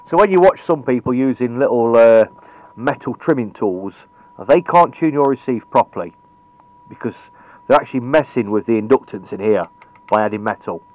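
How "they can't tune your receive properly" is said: ordinary delivery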